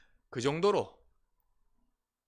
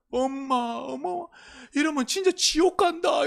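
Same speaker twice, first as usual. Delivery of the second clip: high pleading shout, half in tears; retold tearful shout at mom; crying plea to mother; 11 semitones higher, 4 dB louder than usual. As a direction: beg your mother loudly as if weeping. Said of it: "진짜 제가 울면서요".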